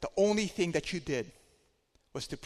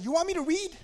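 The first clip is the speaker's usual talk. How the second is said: much higher mocking retort; sarcastic student; 13 semitones higher, 3 dB louder than usual. Student sassing her tutor, mildly mocking high voice. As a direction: throw it back at him with spite. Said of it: "She said".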